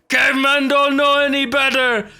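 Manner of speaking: ordinary talk, nothing marked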